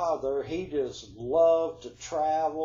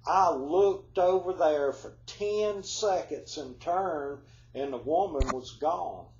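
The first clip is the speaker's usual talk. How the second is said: normal talking voice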